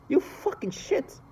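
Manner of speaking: slow, high, breathless hissed insult; hissed curse. Real, not acted. Cursing a mother who harms babies, in a slow hiss. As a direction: spit the curse out in disgust.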